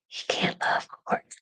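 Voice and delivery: soft whispered aside. Real, not acted. Muttering to the viewers about the dog chewing cords, whispered. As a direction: breathe it under your voice.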